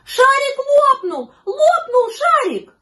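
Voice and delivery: very high, loud wail; high-pitched kids' yelling; children shouting. A narrator's reading. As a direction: cry out like dismayed children. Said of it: "Дети закричали".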